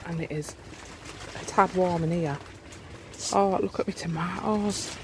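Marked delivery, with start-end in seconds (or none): none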